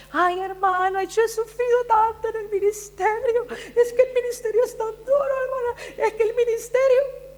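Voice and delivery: high-pitched, whiny mock lament; complaining church women. Preacher mimicking women who suffer in ministry, high mock whine. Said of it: "oigo a mujeres en el, en el ministerio y que siempre dicen".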